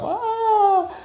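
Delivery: high-pitched mock scream; nightmare scream. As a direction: wake from a bad dream with a put-on shriek. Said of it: "she screams, waking up".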